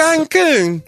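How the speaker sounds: high, loud posh British drawl; British accent. Retold or imitated. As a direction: say it like a pompous Brit. Mocking accent continues.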